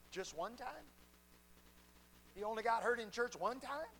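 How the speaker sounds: mock disbelief, rising on time; incredulous. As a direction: ask it as if you can't believe it. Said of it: "I wanted to say".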